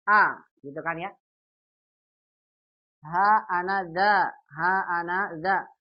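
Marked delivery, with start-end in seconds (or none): 3.1-5.7 "ha ana za" slow, each part apart; split syllables. Shown as a demonstration. Teacher showing the original form "ha ana za" before joining. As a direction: break "ha ana za" into its parts. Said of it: "wajib kita buang alifnya".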